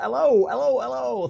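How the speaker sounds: loud, high hello hello hello; bright, booming hello to a new viewer; excited greeting. Real, not acted. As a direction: sing out a big welcome to chat. Greeting chatter loudly.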